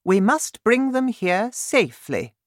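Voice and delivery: high, regal voice of the Queen; Queen's voice. Acted. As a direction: speak up high like a worried queen.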